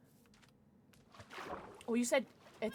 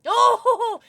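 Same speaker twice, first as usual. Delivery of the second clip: ordinary talk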